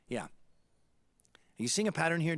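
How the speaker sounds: ordinary delivery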